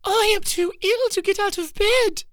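hammed-up sobbing for pity; fake sob. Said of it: "she faked a little sob".

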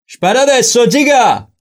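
high, loud yell; bandit's shout. A narrator's reading. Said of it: "urlò quindi il bandito". (urlo acuto da bandito)

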